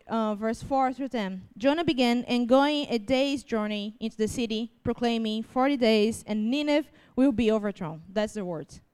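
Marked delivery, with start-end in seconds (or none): none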